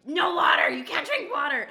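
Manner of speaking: high, loud scolding shout; teacher yelling. Retold or imitated. Mocking the teacher yelling at Sam over water, loud shout. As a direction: yell it at her in front of everyone. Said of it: "starts yelling at her".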